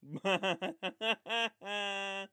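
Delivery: long giggle at own pun; laughing at own joke. Real, not acted. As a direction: chuckle at your own joke.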